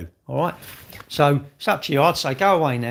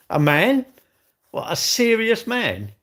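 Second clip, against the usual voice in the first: slow, higher disbelieving echo; incredulous; 6 semitones higher, 4 dB louder, slower than usual. Real, not acted. Streamer echoing a viewer's comment in slow disbelief. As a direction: drag out serious in mock disbelief.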